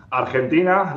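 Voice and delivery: "Argentina" said the Spanish way, ar-HEN-tina with a rolled r; Spanish pronunciation. Shown as a demonstration. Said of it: "let's say in Spanish".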